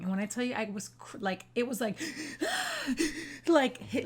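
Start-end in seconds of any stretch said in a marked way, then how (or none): 2.0-3.4 a sharp, high gasp for air, re-enacting the sob; imitated crying gasp. Wife re-enacting her sobbing to listeners with a high gasp. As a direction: show how she couldn't catch her breath. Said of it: "like I couldn't breathe crying".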